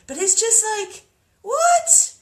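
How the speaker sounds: very high, loud cry of disbelief; incredulous. Real, not acted. Venting at world leaders in shocked disbelief, very high and loud. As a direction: shriek out the utter disbelief.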